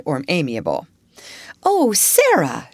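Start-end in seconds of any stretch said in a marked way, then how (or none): 1.6-2.7 high, slow, loud surprise; startled exclamation. A narrator's reading. Headmistress caught out exclaiming to Sarah. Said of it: "she exclaimed".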